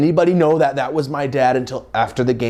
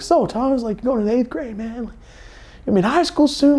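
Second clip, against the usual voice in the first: much higher goofy dad voice; high mock dad; 12 semitones higher than usual. Dad chatting with his son during catch, much higher goofy voice. Dad's voice retold.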